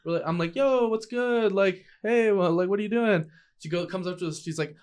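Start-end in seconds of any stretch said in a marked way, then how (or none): 0.6-3.3 high-pitched, casual calling-out; acted friendly hail. Calling to a friend walking by in the trees, upbeat hail.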